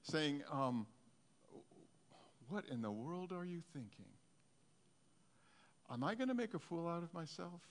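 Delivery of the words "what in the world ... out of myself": hushed questioning, rising in pitch; inner doubting voice. Acted. Preacher acting the doubt after stepping out, hushed. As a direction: softly voice the nagging self-doubt. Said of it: "this voice saying".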